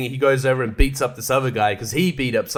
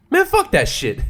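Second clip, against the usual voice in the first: exasperated outburst. Real, not acted. Venting about cinemas giving up.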